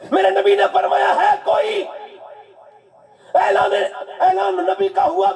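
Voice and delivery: high, rousing sermon delivery; fiery Urdu sermon storytelling; impassioned preaching. Acted. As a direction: preach it with rising fervour.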